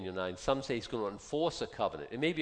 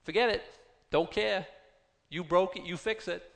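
dismissive imagined God; 5 semitones higher than usual. Retold or imitated. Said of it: "I'm so sure God's saying to me".

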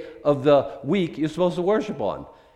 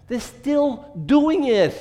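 emphatic; 10 semitones higher, 6 dB louder, much slower than usual. Real, not acted. Preacher insists.

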